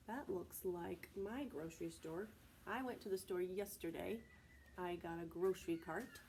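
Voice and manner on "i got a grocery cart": usual speaking voice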